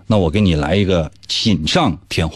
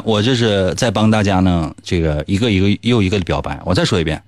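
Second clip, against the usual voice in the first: ordinary talk, nothing marked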